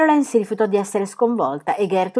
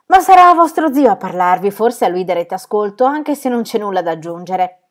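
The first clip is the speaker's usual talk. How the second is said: loud, higher, furious tirade; losing control; 3 semitones higher, 8 dB louder than usual. Acted. Aunt loses her temper with her nieces, loud furious tirade. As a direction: explode at the ungrateful nieces. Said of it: "perdendo alla fine il controllo".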